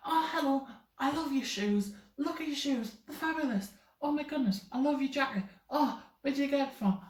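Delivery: high-pitched mock gushing compliments; over-friendly gushing. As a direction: gush over a stranger, hammed-up and high. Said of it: "I'm not gonna be like that".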